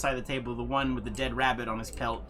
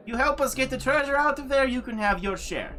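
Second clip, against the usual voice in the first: high, eager put-on voice for the adventurer; smarmy adventurer; 12 semitones higher, 4 dB louder than usual. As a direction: play him overly polite and slick, pitched up. NPC pitches the deal.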